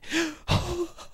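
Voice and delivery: high awed gasp; mock gasp; imitated gasp. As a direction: gasp in awe.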